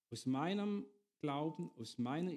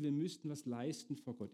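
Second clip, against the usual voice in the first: ordinary delivery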